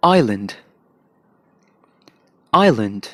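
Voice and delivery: आइलैंड in a British accent, silent s; British English island. Shown as a demonstration. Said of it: "British में इसे कैसे कहेंगे".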